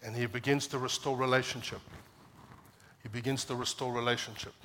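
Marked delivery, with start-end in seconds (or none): none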